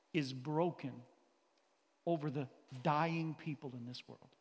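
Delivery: ordinary talk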